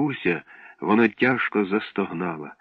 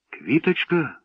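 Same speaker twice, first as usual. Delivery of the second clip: higher, startled question; alarmed cry; 7 semitones higher, slower than usual. A narrator's reading. Boy hears the flower was smashed, alarmed cry. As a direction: gasp out the word in alarm. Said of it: "схвильовано скрикнув Славко".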